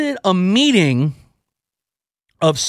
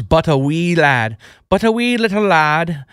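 playful put-on Irish accent. Radio host recalling his gaming childhood, mock Irish accent. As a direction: slip into a jokey Irish lilt. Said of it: "speaking with an Irish accent".

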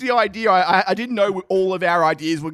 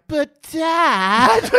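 put-on higher whine of a teenager to his father; whiny kid; 8 semitones higher, 4 dB louder than usual. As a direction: son whining to his dad about running. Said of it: "And I'm like".

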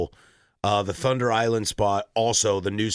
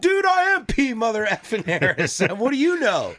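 much higher and louder, hammed-up swagger; mock cocky shout; 13 semitones higher, 4 dB louder than usual. Pretending to boast to a fan, a hammed-up cocky shout. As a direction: bellow it like an arrogant jerk, laughing through the end. Imagined brash confrontation with a fan.

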